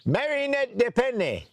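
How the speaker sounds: put-on high, slow French accent calling a name; French official. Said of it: "he's like".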